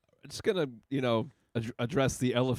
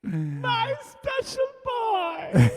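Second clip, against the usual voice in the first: very high, gushing mock-mother voice; doting falsetto; 3 dB louder, much slower than usual. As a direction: coo over a special boy, slowing and stretching the end. Repeats the doting voice.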